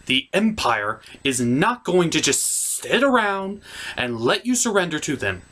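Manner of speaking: ordinary talk, nothing marked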